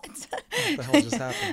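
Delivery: high, slow laughing disbelief. Real, not acted. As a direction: gasp it out laughing.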